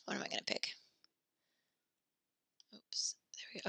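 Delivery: very soft, near-whispered thinking aloud; muttering to self. Real, not acted. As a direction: whisper to yourself as you search for an answer. Muttering to self while choosing.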